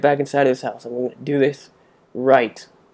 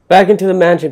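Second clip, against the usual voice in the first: higher, louder rallying call; being chased; 5 semitones higher, 8 dB louder, faster than usual. Real, not acted. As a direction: shout the team onward.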